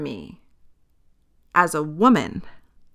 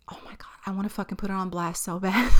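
fast, hushed confession; conspiratorial whisper; 10 dB quieter, faster than usual. Real, not acted. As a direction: whisper it like a guilty secret. Confessing secret urge, whispered.

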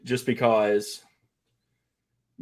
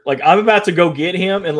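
usual speaking voice

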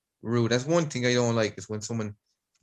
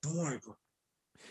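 softer and higher mock mumble; muttered aside; 4 semitones higher, 10 dB quieter than usual. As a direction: drop it under the breath as a joke. Echoing the shop line, muttered.

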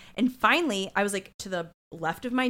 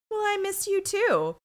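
high-pitched coy quote; flirty Olivia; 11 semitones higher than usual. Acted. Voices Olivia flirting back.

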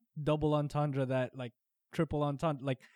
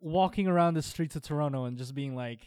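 ordinary talk

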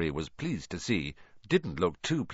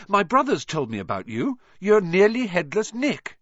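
put-on eager boy; 10 semitones higher, 5 dB louder than usual. A narrator's reading. Ron names the ghost.

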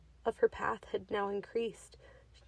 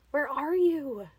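loud, higher shout; 5 semitones higher, 6 dB louder, slower than usual. A narrator's reading. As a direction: yell into the ravine. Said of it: "she shouted".

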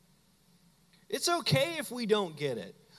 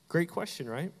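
usual speaking voice

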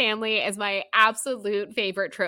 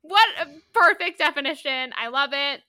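raised, loud fan enthusiasm; gushing praise; 6 semitones higher, 7 dB louder than usual. Real, not acted. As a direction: heap praise with a laugh breaking in.

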